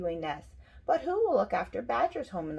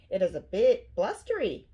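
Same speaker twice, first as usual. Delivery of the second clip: ordinary delivery, nothing marked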